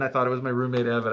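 ordinary talk, nothing marked

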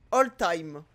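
ordinary talk

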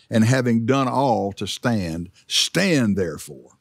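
slow, pressing "stand" each time; emphasis on stand. Shown as a demonstration. Pastor reading scripture to congregation, stressing "stand". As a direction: dwell on "stand". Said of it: "notice the emphasis on stand".

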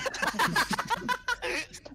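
squealing high laugh; high burst of laughter. Real, not acted. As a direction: break into a high-pitched laugh.

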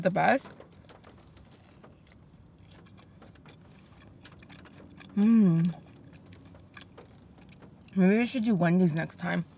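chewing while talking; speaking between bites; mouth full. Real, not acted. Seen 2: holds onion ring, bites at 4.6s, chewing between words.

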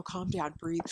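ordinary talk

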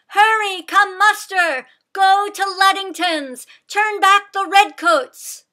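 high, loud, urgent call to arms; rallying cry. A narrator's reading. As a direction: cry out to rouse the patriots. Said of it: "Sybil cried out to the patriots".

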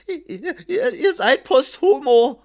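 stammering, high-pitched terrified scribe; frightened. A narrator's reading. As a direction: stammer it out in tearful fear. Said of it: "antwortete der Schreiber verängstigt". (verängstigt stotternd, mit hoher Stimme)

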